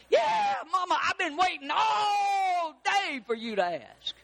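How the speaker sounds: high, wailing mock shout; hammed-up retort. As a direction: shout it back at mama, stretched out slowly. Acts a sassy retort to mama.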